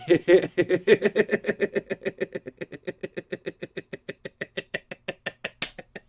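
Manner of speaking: long helpless laugh, pitch up; high wheezing laugh. Real, not acted. Creator cracking up at his own snap. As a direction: laugh until you run out of air.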